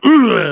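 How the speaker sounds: sharp high grunt of effort; retold shove grunt, pitched high. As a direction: grunt as you shove someone. Shoving Billy's head into wall.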